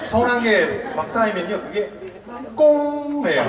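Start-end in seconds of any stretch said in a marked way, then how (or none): 2.5-3.4 꽁 held long, high and loud; drawn-out 꽁. Acted. Monk shows the audience what piled-up hurt becomes, drawn-out 꽁. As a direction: stretch 꽁 out for effect. Acts out the pent-up word.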